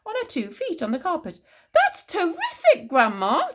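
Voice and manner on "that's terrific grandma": high, loud delighted exclamation; excited cry. A narrator's reading. George seeing Grandma on her feet, excited cry. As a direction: cry it out, amazed and thrilled. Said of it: "George cried".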